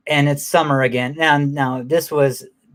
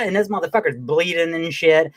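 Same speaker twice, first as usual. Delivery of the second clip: ordinary talk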